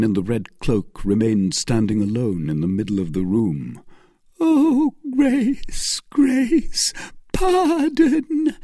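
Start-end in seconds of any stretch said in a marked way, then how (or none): 4.4-8.6 very high, begging cry; Milady on her knees begging mercy; desperate pleading. A narrator's reading. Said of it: "cried the wretch, falling on her knees".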